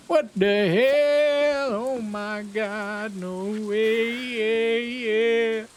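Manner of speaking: high, very slow, stretched "what the hell"; shocked outburst. Real, not acted. Streamer to chat, stunned by the joke, drawn out and high. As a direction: let the disbelief drag out every word.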